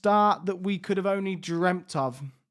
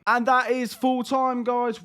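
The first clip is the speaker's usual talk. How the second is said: higher and louder, calling the final whistle; excited full-time call; 6 semitones higher, 3 dB louder than usual. Real, not acted. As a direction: announce full time with a lift of joy.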